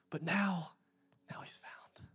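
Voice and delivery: whispered reveal that he's found. Acted. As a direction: drop to a hushed whisper. Dramatic retelling of parable.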